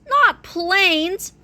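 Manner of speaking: plain reading voice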